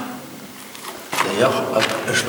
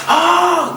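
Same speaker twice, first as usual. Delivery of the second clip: sudden high yelp; startled yelp. Retold or imitated. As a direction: yelp like you just got spooked. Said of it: "il t'a fait".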